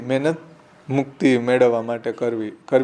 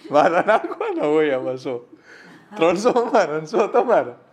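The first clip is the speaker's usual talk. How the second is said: talking through real laughter; laughing; 4 semitones higher than usual. Real, not acted. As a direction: laugh at your own joke. Joking back at the neighbor.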